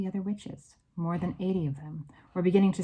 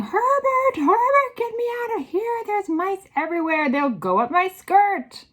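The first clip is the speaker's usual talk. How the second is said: shrill, very high panicked shriek; Missus Jenkins screaming; 9 dB louder than usual. A narrator's reading. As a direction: scream hysterically for rescue. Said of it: "it was screaming".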